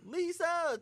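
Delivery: high, drawn-out call; mock calling out. Roland knocking on the door for Lisa, high call. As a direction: call her name through a door. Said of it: "knocking on the door saying".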